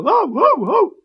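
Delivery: high, very loud puppy bark. A narrator's reading. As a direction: bark the word ГАВ at full volume. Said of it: "громко лаял". (громкий звонкий щенячий лай)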